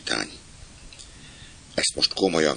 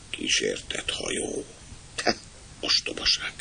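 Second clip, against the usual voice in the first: awed whisper; 6 semitones lower, 8 dB quieter than usual. Acted. Jimmy alone at the empty cabin, whispering. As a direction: whisper it to yourself, spooked. Frightened thought about a haunted ship.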